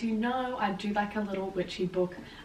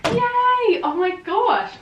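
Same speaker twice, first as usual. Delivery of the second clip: high, loud squeal of joy; excited cheer; 12 semitones higher, 7 dB louder, much slower than usual. Real, not acted. Showing viewers her stack of books, squealing with joy. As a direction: burst out cheering.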